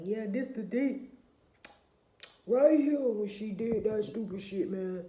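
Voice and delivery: a hint of an indignant little boy; mock aggrieved boy. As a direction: put on a boy loudly griping over his proof. Acting out boys showing saved proof.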